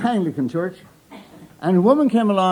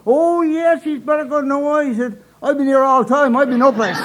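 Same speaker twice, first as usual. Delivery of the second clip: put-on much higher, proud old outport man; old Newfoundlander's voice; 9 semitones higher, 4 dB louder than usual. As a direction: answer proudly in a high old voice. Old brother's proud reply, quoted.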